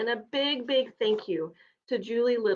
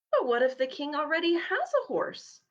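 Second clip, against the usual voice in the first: timid; 7 semitones higher than usual. Acted. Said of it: "asked Rufus timidly".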